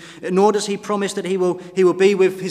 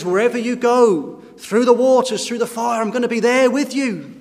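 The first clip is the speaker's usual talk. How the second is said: higher, louder voicing of the Lord's promise; raised promise; 5 semitones higher, 3 dB louder than usual. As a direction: lift it up like a fervent promise. Preacher voices the Lord's promise.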